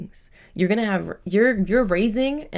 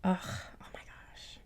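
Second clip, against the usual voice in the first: a slow, breathy whisper, overcome with feeling; emotional whisper; 13 dB quieter, slower than usual. Real, not acted. Tarot reader moved by a mother and son reading, whispering softly. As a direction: let the emotion catch in your throat and whisper it. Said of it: "why am I getting emotional".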